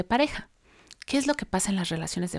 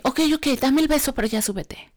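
higher, impatient retold concession; not loving; 6 semitones higher than usual. Annoyed mom sends son back to bed, retold impatience. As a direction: give in, annoyed, then drop the pitch. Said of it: "no fue amorosamente que le dije".

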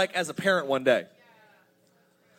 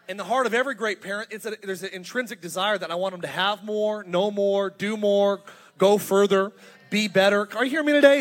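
building louder preacher cadence; preaching build-up; 6 dB louder than usual. Acted. Pastor building to a loud climax, preaching. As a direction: drive it home to the crowd.

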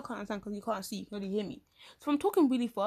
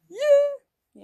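high squeal; 13 dB louder than usual. Real, not acted. Squealed yeah after laughing.

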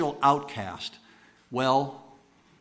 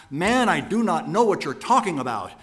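loud, high panicked denial; terrified shout; 5 semitones higher, 7 dB louder than usual. A narrator's reading. Peter, terrified, shouting his denial at the bystander. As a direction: shout back in fear for his life. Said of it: "shouted back".

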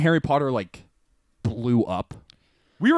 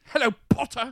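higher, posh British voice; posh British accent; 6 semitones higher, 4 dB quieter than usual. Acted.

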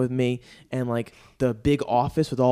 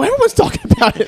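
very high, falsetto-like cheeky claim; mock innocent bluff; 9 dB louder than usual. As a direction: play dumb to sell the bluff. Bluffing the dealer about rates.

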